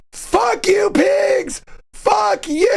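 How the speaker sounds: high yelled taunt at the cops; mimicking a friend screaming at cops; retold shout. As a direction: yell it out the window, fist pumping. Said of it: "and he's going".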